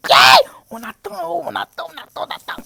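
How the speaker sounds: shout fading. Real, not acted. Eager waiting, fading out.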